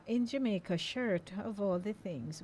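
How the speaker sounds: ordinary talk, nothing marked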